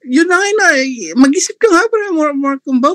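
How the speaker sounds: heated scolding. Real, not acted. Scolding opponent in debate.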